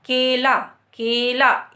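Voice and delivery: केला with a clear, open ए. Shown as a demonstration. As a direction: say केला crisply stressing the ए. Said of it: "ए का उच्चारण साफ स्पष्ट सुनाई देता है केला".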